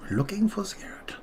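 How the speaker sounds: a hammed-up German whisper; German accent. Trader teasing viewers in a mock German accent. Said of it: "I don't know why I do a German accent".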